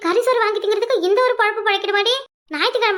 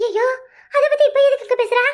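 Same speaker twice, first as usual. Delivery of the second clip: alarmed outburst; 5 semitones higher, 3 dB louder than usual. Acted. Mother alarmed secret is revealed.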